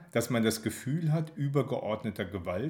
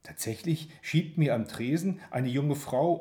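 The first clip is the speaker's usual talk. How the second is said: plain reading voice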